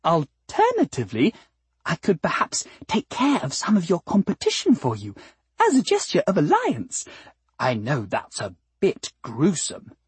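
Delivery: high, anxious, sweet lilt; distressed flower. A narrator's reading. Flower offering to remove the cat's competition, distressed but sweet. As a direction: offer it anxiously, high then dropping and slowing. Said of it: "quivered her petals in distress".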